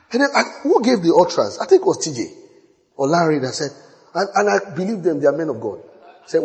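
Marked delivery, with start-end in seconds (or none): none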